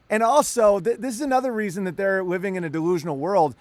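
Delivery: ordinary talk, nothing marked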